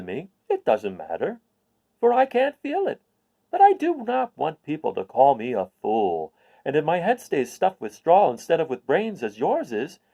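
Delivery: lightly confiding straw man; confiding scarecrow. Acted. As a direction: share it like a secret worry. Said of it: "he continued confidentially".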